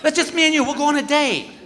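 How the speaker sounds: high, giggly coaxing wife; wife's date pitch. Retold or imitated. Wife pleading for a date night, high and playful. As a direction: coax him sweetly into a date. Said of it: "she goes (wife's date request)".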